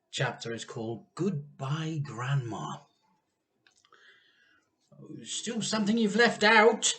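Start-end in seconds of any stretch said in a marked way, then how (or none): 5.2-6.9 high, loud voice for Mister Cranky. A narrator's reading. Reading to a child, Mister Cranky speaks higher and louder. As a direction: pitch Mister Cranky up and push it. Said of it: "Mister Cranky said".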